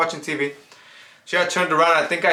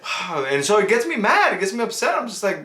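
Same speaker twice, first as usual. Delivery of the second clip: normal talking voice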